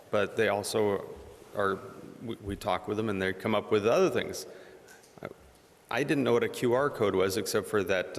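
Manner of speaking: ordinary talk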